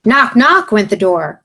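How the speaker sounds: ordinary delivery, nothing marked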